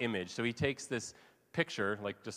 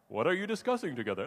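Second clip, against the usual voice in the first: higher, quick feigned-innocent question; put-on voice; 5 semitones higher, faster than usual. Jesus pranking the two men on the road, put-on voice. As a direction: ask it in a playfully disguised voice. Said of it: "putting on this, like, you know, changes his voice".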